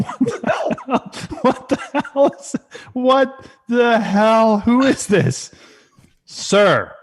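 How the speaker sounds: high, slow, drawn-out disbelief; shocked. Acted. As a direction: gasp it out at a stranger. Shocked at a doll behind him.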